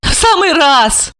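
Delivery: high, slow smirking reply; amused smirk. A narrator's reading. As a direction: brush him off with a smirk. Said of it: "усмехнулась она".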